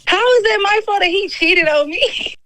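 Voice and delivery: high-pitched, incredulous protest; indignant disbelief. Real, not acted. Caller protesting to host that the cheating isn't her fault, indignant. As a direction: push the question up high in real disbelief.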